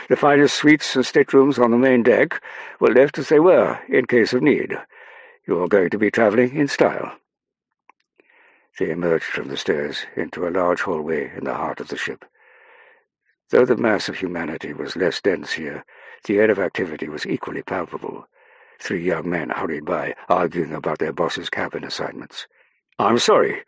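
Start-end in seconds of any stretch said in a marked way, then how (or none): 0.1-7.2 high, loud upper-class Englishman; upper-class English officer. Acted. Colville assuring Saffron of fine staterooms, loud upper-class voice. 23.0-23.6 raised, louder insistence; insistent. A narrator's reading. Young man arguing about cabin assignments, insistent. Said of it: "one insisted".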